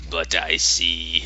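lightly voiced low, slow bear; Yorick. Bear says what others pretend not to see, low and slow. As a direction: drop low and draw it out slightly. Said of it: "he talks about the people of Trolloson".

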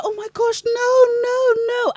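high, loud panicked "no, no, no"; mock English tourists squealing in panic. Imitating English tourists crying for the monkey, high panicked squeal. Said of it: "they're like".

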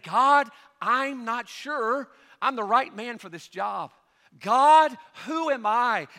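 slow, then a loud high cry of God; pleading Moses. Retold or imitated. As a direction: Moses begging, voice jumping up on God. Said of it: "Now here's Moses saying".

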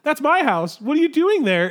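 put-on high, loud indignant old lady voice. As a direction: squawk it like a startled old homeowner.

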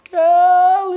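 high, loud drawn-out name call; sustained high-pitched shout; distant calling out. Retold or imitated. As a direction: holler the name across a lake, long and high. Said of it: "I suddenly heard a voice".

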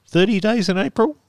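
thirty days in April as a rising question; asking. Shown as a demonstration. Host unsure of the date, asking himself. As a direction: check the date aloud, lifting April. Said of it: "Yes, thirty days in April".

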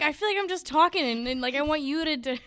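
loud, high complaint; frustrated. Retold or imitated. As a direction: vent it loudly. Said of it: "I was always like".

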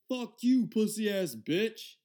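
loud, high mock shriek of an insult; high-pitched hollered insult, acted out; retold yelling. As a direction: imitate an angry woman yelling, drawing out the end. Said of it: "she just yelling at me".

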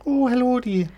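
put-on high, matronly old dear greeting; Missus Doubtfire. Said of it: "Missus Doubtfire".

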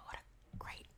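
hushed swooning whisper. Real, not acted. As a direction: whisper like a secret. Gushing about actor.

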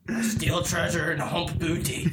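slow, hammed-up pirate voice; Redbeard. Pirate introducing himself to laughing friends, mock swagger. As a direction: boast like a cartoon pirate. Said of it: "My name's Redbeard".

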